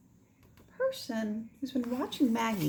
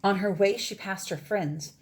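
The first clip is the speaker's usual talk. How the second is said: ordinary delivery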